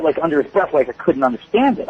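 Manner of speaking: ordinary talk, nothing marked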